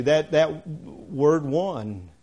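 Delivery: "one" drawn out very slowly and weighted; slow stress on one. Shown as a demonstration. Preacher singling out the word "one" for the congregation, slow stress. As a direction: lean hard on "one", slowly. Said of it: "the point that Paul is stressing".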